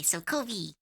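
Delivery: "Covey" said KOH-vee, soft; name pronunciation. Shown as a demonstration. Telling chat how the name is said, "Covey" as KOH-vee. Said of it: "I pronounced it Covey".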